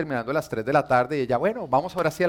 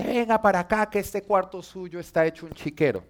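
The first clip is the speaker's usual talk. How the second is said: mom scolding; 6 semitones higher than usual. Retold or imitated. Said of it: "Me dice".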